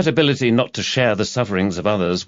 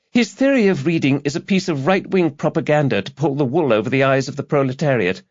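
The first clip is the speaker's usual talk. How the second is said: put-on high young woman's voice, preaching her politics; earnest young activist; 7 semitones higher than usual. A narrator's reading. Student activist lecturing the detective, high zealous voice. Girl denounces theory.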